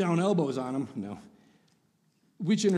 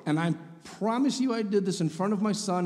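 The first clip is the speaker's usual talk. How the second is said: ordinary talk, nothing marked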